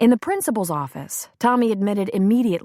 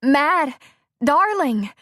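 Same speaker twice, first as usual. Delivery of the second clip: louder; 9 semitones higher, 4 dB louder, much slower than usual. A narrator's reading.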